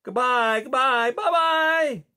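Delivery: high, loud calling goodbyes; children crying out. A narrator's reading. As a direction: call out after them, loud and high. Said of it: "cried all the other children".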